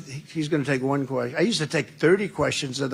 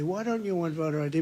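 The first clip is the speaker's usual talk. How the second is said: ordinary talk, nothing marked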